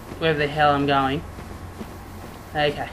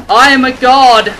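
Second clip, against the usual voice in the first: loud, higher-pitched crowing; triumphant boast; 6 semitones higher, 10 dB louder than usual. Real, not acted. Gamer crowing over his own survival, triumphant shout. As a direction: shout it like a victor. Gloating at dodging in game.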